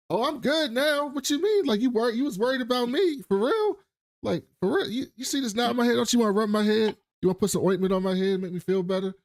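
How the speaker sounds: high, fast mock whine fishing for sympathy; flirty injured man. Injured man angling for the woman to tend his head, high fast hammed-up whine. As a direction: play it up high and quick, begging to be babied.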